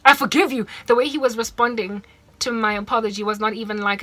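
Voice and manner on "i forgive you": impatient forgiveness. Retold or imitated. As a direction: toss off the forgiveness like an annoyed boyfriend. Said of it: "He was aggressive".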